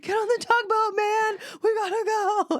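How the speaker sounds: high, loud playful shout. Pretend. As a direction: bellow it to tease your teen. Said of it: "I will just shout".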